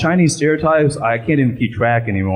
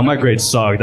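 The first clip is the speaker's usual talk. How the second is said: ordinary talk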